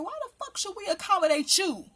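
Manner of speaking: tearing into him, voice up and hot; angry rant. Real, not acted.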